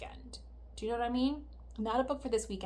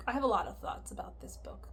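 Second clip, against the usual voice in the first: whisper. Real, not acted. Unvoiced aside.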